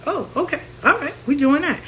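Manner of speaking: high, thrown-off "oh okay"; sarcastic surprise; taken aback. Real, not acted. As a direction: react to an unexpected comeback. Reacting to friend's retort.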